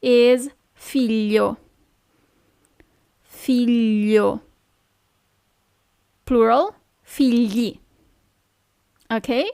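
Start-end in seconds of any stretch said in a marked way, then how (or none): 0.0-4.4 figlio drawn out slowly, FEE-lyo; slow model word. Shown as a demonstration. Teacher slowly models figlio for the class. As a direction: say figlio slowly so learners hear it.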